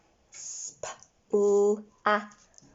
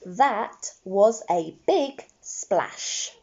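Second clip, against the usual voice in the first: slow model sentence; much slower than usual. Shown as a demonstration. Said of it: "The sentence I would like to write".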